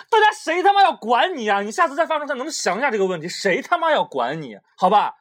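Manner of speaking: mock outraged tirade; mock rant. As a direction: scold like a furious aunt, playfully. Mock rant at friend's post.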